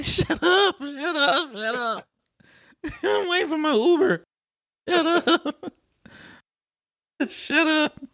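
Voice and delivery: very high laughing shut up; high laughing mock protest; mock squeal. Retold embarrassed reaction.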